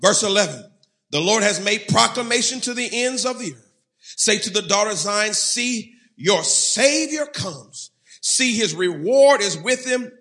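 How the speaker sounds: high, loud proclamation; ringing pulpit reading; preached scripture reading. Real, not acted. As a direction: proclaim the verse like a herald.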